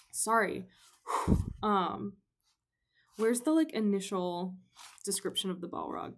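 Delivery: normal talking voice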